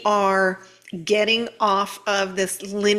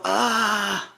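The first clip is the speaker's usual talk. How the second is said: mock exasperated whine, pitched way up; whiny frustrated self; 7 semitones higher than usual. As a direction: whine it like a fed-up kid. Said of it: "But when I go".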